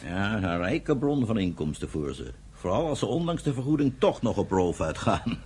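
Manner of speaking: wry sarcastic jab about the Bedouins; dry sarcasm. Acted. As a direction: let the irony sit under the words. Dry remark about Bedouin payments.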